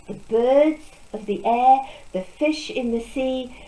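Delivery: plain reading voice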